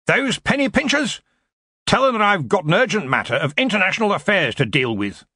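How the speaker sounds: high-pitched indignant outburst; indignant old wizard in command; blustering Arch-Chancellor. Acted. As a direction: dismiss them with bluster. Voices Ridcully, the blustering Arch-Chancellor.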